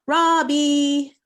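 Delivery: loud, high, drawn-out call. Acted. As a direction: sing out the name to summon someone. Calls the puppet out after counting.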